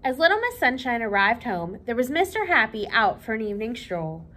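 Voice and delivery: lively read-aloud for children, voicing each character; storybook reading. A narrator's reading. Librarian reading Little Miss Sunshine to children, lively storytelling.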